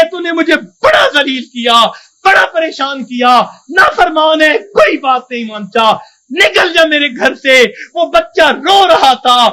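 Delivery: preacher voicing an angry mother through tears; dramatic preaching. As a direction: tell it with mounting anguish.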